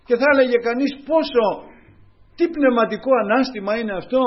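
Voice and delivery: high, loud fervent preaching; preaching at full force. Real, not acted. Preacher stirring the congregation with Elijah's story, fervent. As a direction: lift the whole church with Elijah's story.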